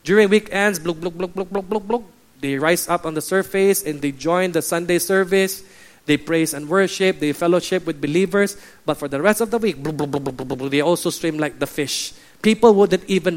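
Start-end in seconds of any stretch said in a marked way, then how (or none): 0.8-2.0 quick repeated bubble noises; imitated bubbling. Preacher picturing Christians surfacing at weekends, bubbling noises. 9.8-10.7 rapid run of sinking bubble noises; imitated bubbling. Preacher picturing Christians submerging all week, bubbling noises. Imitates fish going back under.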